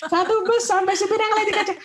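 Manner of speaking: mother tells friend how whole bus stared, worked up and loud; exasperated telling. Real, not acted. Mother recalls embarrassment on bus.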